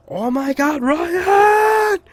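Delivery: hammed-up high-pitched girlfriend scream; mock angry yelling. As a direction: shriek it like an exasperated girlfriend, rising loud. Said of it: "she's, she's yelling".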